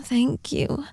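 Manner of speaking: ordinary talk, nothing marked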